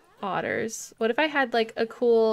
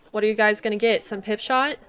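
ordinary talk

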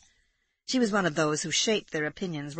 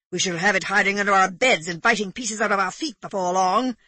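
louder, grumbling gentleman; Egbert gloomy; 2 semitones higher, 6 dB louder than usual. A narrator's reading. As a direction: predict doom with mild exaggeration. Narrator voices Egbert.

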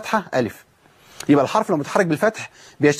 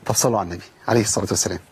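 ordinary talk, nothing marked

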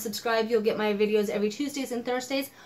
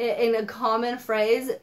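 ordinary talk, nothing marked

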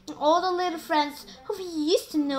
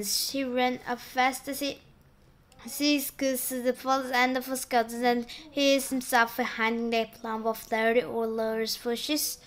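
ordinary delivery, nothing marked

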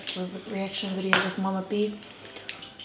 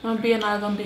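eating crab between words; talking while chewing; 3 dB louder than usual. Real, not acted. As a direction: mouth partly full. Seen 0: Left speaker ate at -1, chews at start, holds crab throughout.

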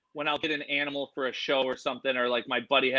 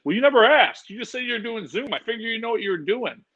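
imitating tech buddy; 8 semitones higher than usual. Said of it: "he's like".

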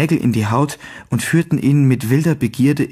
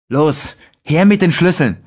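louder, higher, clenched angry demand; hissing with barely held rage; 4 semitones higher, 4 dB louder than usual. A narrator's reading. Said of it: "zischte er mit kaum verhaltener Wut".

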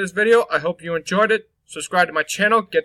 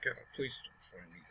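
whispering while hiding; 10 semitones lower, 22 dB quieter than usual. Real, not acted. Hiding from monster in game.

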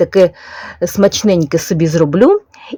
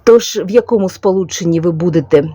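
usual speaking voice

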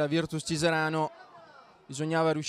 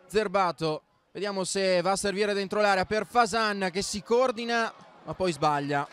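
normal talking voice

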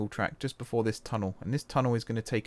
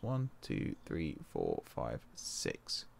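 fading count; 9 semitones lower, 10 dB quieter, much slower than usual. Real, not acted. Counting signal gap tiles to himself, fading to a whisper.

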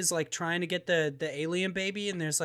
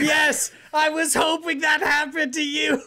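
loud, high, laughing glee; gleeful laughing shout; 9 semitones higher, 5 dB louder than usual. Real, not acted. Friend hears the game demanded a controller, loud laughing glee. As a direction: cackle with delight at his bad luck.

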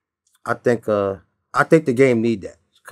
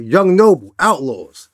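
loud, confrontational self-introduction, imitated; real aggressive; 2 semitones higher, 5 dB louder, much slower than usual. Caller repeating his name to a rival, imitated aggression. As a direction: snap the name out like a threat. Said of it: "Like, real aggressive".

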